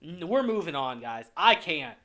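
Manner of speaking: ordinary talk, nothing marked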